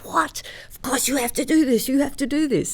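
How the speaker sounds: high, insistent mock astonishment; amazed urging. Singer acts out husband hearing of the duet offer, excitedly urging.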